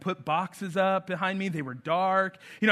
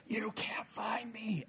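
whisper; 14 dB quieter, slower than usual. Retold or imitated. Son whispers from under bed.

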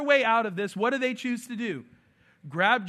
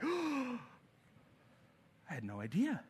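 mock surprised God; 12 dB quieter than usual. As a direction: play God pretending to be caught off guard.

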